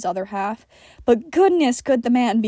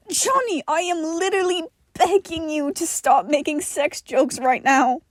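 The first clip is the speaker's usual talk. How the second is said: exasperated plea; 6 semitones higher than usual. A narrator's reading. John exasperated with Johnny.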